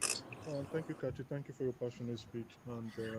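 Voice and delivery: faint, far-off low voice; barely audible. Real, not acted. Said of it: "Your voice is so low".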